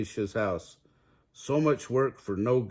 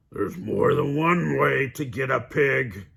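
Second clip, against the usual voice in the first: louder sly wolf voice; cunning wolf; 4 semitones higher, 4 dB louder than usual. A narrator's reading. Wolf on the roof, slyly plotting. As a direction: scheme out loud. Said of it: "he cried".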